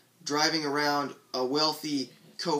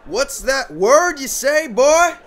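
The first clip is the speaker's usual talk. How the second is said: high, loud, drawn-out jeer; heckling yell; 11 semitones higher, 9 dB louder, slower than usual. Acted. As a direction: yell it across a rowdy crowd. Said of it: "and they yelled".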